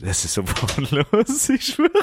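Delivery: breaking into laughter mid-sentence; can't keep a straight face, laugh through it. Real, not acted. Laughing at his co-host rushing off to the toilet.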